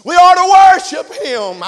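loud, high shouted call; fervent shouted exhortation; preaching at full force. Real, not acted. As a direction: drive the climax home. Preacher building to climax.

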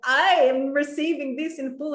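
delighted at the free drink, voice jumping up; thrilled declaration. Retold or imitated. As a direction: announce it like a gift arriving. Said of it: "So I was like".

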